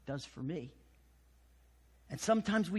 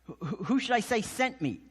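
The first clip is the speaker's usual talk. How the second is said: laughing in disbelief, higher; mock-flustered, higher and quick; startled Moses; 3 semitones higher than usual. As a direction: Moses balking at God's order, higher and laughing. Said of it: "And Moses is like".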